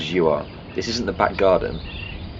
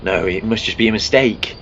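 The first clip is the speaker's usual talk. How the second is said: ordinary talk, nothing marked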